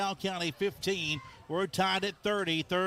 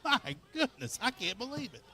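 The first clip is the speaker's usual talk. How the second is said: high, breathless disbelief; amazed; 7 semitones higher than usual. Real, not acted. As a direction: marvel at the luck. Another penalty, laughing.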